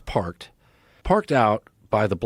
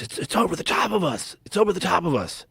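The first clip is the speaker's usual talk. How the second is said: put-on a terrified kid in the car warning his friend; panicked whisper-yell; 6 semitones higher than usual. Frightened young man in a car, pitched high and panicky. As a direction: voice pitched up high in fear, words rushed. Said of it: "And then one goes".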